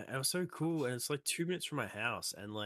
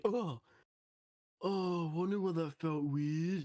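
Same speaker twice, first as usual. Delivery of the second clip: high, slow, dopey voice of a man getting zapped; slow and dim-witted, pitched up; dazed guy; 8 semitones higher, 4 dB louder, slower than usual. Retold or imitated. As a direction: play the clueless guy holding the live wire. Imitating the dazed guy on electric fence.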